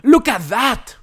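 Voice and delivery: high, loud outraged shout; high outraged yell. Real, not acted. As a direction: yell at the screen in disbelief. Outraged at foul on screen.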